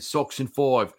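Sox in Five with a mock Australian accent, FIVE said like foive; hammed-up Aussie twang on Five as foive. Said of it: "I gotta do it in the Aussie accent".

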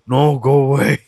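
Rusev imitation. Wrestler refusing to be in Lana's snaps, mock protest. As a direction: grumble it as a put-upon Rusev.

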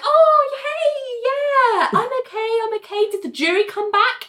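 high, laughing brave-face voice; plucky patient. Retold or imitated. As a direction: put on a chirpy brave face. Injured Ali greeting colleagues.

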